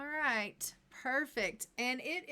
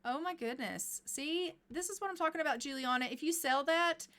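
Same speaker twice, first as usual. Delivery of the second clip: usual speaking voice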